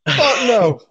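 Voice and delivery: high, loud fuck no; loud, high-pitched outcry; loud outburst. Real, not acted. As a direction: blurt it out in disbelief. Hearing Roman can't stand Seth.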